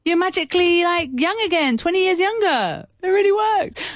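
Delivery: high, gushing miracle-cure pitch; hyped-up amazement. Acted. Host jokingly promises her face drops work miracles, hyped-up amazement. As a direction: oversell it in a high, excited voice. Hyped mock claim about vitamin C.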